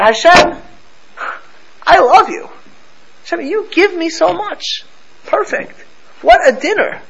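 high, excited gushing to God; lifted, delighted voice of thanks. Retold or imitated. Voicing grateful prayer to God.

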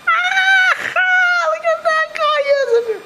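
high, loud, laughing mock of jeering inmates; squealing mockery. Rabbi voicing jeering asylum inmates, high squealing laughter. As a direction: shriek it like a mocking crowd.

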